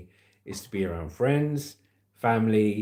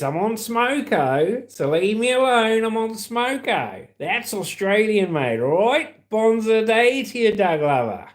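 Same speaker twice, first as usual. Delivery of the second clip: higher-pitched mock Australian bloke; Australian accent; 7 semitones higher, 3 dB louder than usual. Shown as a demonstration. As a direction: ham up an Aussie accent. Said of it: "if I wanted to speak Australian".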